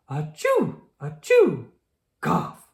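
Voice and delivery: loud sneezes, then a cough; sneezing fits. Acted. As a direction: sneeze hard twice and cough. Sick pony sneezing.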